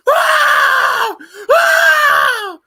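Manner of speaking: shrill mock horror-movie scream; imitated high scream. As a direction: scream like a scared heroine, high and loud. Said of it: "she's like this".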